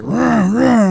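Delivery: high, loud mock roar of an angry driver; mock angry growl. Mocking the impatient driver yelling, loud mock roar. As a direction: bark it like a hothead revving at you. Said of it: "this dude's like".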